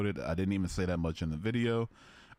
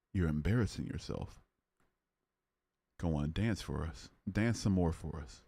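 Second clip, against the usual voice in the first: ordinary talk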